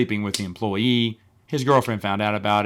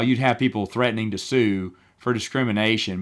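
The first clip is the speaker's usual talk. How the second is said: normal talking voice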